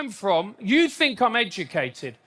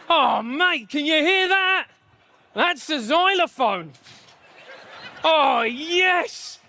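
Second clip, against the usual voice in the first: higher, thrilled at the music; excited diner; 6 semitones higher, slower than usual. Acted. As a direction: act out a mate loving the tune.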